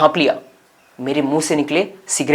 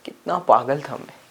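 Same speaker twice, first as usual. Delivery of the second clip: quiet self-reproach; 3 semitones lower, 9 dB quieter than usual. Acted. Regrets his old foolishness.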